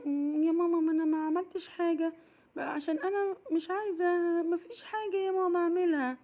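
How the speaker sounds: put-on high child voice, quickening; a small girl caught out; sheepish little girl. Raghad admitting to mama, high sheepish child voice.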